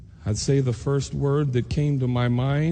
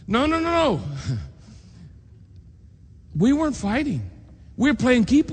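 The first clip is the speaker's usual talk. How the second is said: put-on child protesting; 10 semitones higher than usual. Boy John protests to teacher.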